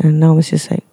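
ordinary talk

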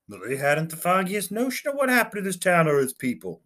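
a keen junior officer reporting brightly; eager lieutenant. Acted. Lieutenant reporting to his captain, lightly voiced eager.

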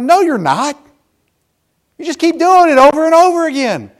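loud, high fed-up dad; exasperated retort. Retold or imitated. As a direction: fire back in exasperation, voice climbing high. Fed-up father's retort, acted.

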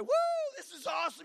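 high-pitched mock cheer; imitated excited whooping. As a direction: whoop it like the thrilled pastor. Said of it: "shouting on the other end".

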